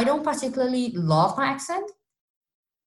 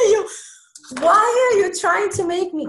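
loud, high-pitched protest; mock indignant outburst; retold exasperation; 12 semitones higher, 5 dB louder than usual. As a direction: act out a fed-up "why me" plea. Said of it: "make me really upset".

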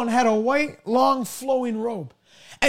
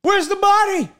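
higher, urgent call of alarm; alarmed cry; 7 semitones higher, 4 dB louder than usual. Acted. Nurse finds the empty bed and cries out, higher and alarmed. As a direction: cry out, searching the room.

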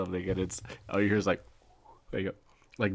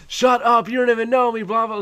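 high, loud retort in a shouting match; screaming back; 13 semitones higher, 7 dB louder than usual. Retold or imitated. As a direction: yell it back, imitating an arguing gamer. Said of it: "screaming, and they're just, like, going back and forth".

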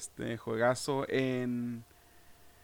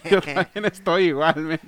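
laughing through the words; 2 semitones higher, 7 dB louder than usual. Real, not acted. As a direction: chuckle while admitting it. Both struggling to pick, laughing.

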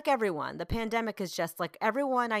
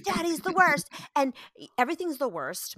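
high mock-dramatic kid complaint; dramatic child; 6 semitones higher than usual. Mom imitates her seven-year-old, dramatically fed up. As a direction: imitate an overdramatic kid, pitched up. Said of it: "just very dramatic".

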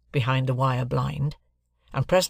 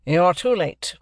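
higher, louder, brusque dismissal; curt lawyer Jaggers; 5 semitones higher, 5 dB louder, slower than usual. Acted. Jaggers dismisses a pleading man, curt and forceful. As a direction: cut the petitioner off firmly.

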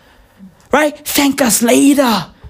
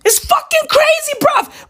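excited shout. Real, not acted. Exclaiming at the trend.

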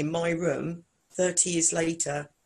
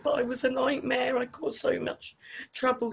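higher, a lightly voiced guilty pupil; imitating her younger self; 9 semitones higher than usual. Apologising to her old teacher, a lightly voiced guilty schoolgirl. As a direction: confess it like a sorry schoolgirl. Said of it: "I said".

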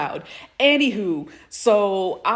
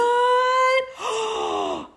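mock gasp; 14 semitones higher than usual. Imitating Cecil's reaction.

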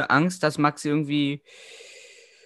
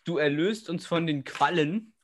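talking with a clothespin in the mouth; 5 dB quieter than usual. Real, not acted. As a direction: say it with a clothespin clamped between the lips. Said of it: "hast du eine Wäscheklammer im Mund".

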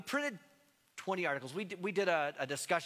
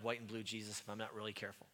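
ordinary talk

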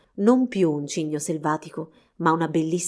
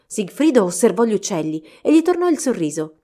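ordinary delivery, nothing marked

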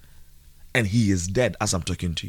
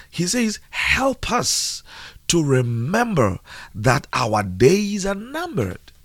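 slow, high-pitched emphatic preaching; 10 semitones higher, slower than usual. Acted. Preacher urging listeners to obey God, preaching. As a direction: preach it slowly, stretching the key words.

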